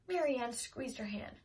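plain reading voice

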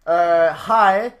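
loud, furious rant, half laughing; angry Québécois customer. Retold or imitated. Woman scolding him for speaking English, shouting. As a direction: blast him in Québécois French like a fed-up local. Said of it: "This bitch was like".

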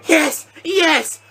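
high-pitched cheer; excited celebration. Real, not acted. Gamer seeing the ball go in, high excited cries. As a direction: cheer in sudden high relief.

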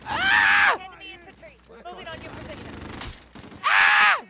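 very high loud scream. Real, not acted. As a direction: scream when hit. Game hit scream.

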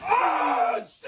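sudden shocked yell; pained scream. Real, not acted. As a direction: cry out as the wax rips. Grandson's leg waxed by surprise.

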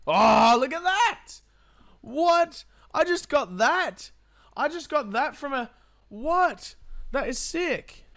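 thrilled shouting over a new melee; real giddy excitement; excited. Real, not acted. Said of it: "Sorry, I'm a little bit excited".

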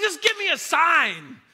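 slow, loud, high begging; hammed-up demand for a sign; mock pleading. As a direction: plead like a doubter wanting proof. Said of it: "You're like".

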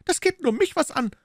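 very high, defiant retort. Acted. Maid defying her mistress, very high pitch. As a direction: throw it back at them, stubborn. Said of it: "sagte die Frau trotzig".

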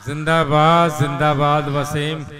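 loud, slow cheer of praise. Real, not acted. Host cheering Wasim after his nazm, loud and slow. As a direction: hail the poet in front of everyone.

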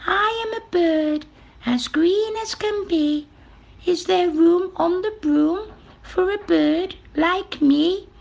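high, piping bird voice; little green bird. A narrator's reading. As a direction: play a polite bird in a very high pitch. Green bird speaks in the story.

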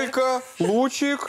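high, loud astonished cry; surprised exclaim. Real, not acted. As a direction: gasp it out in disbelief. Host exclaims at dog's theft.

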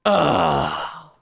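long, low exasperated groan; drawn-out fed-up "ugh"; frustrated groan. Real, not acted. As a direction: groan it out, pitch sinking. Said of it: "I'm pissed".

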